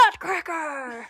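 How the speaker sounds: slow, stretched announcer call; game show host scream. Retold or imitated. The host announces the groin hit with a slow hammed-up yell. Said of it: "And the host screams".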